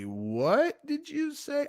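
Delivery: slow, incredulous, climbing very high on the question; soft start rising into a high-pitched double take; mock disbelief. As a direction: act appalled, stretching "what" upward. Acting out shocked listeners' reaction.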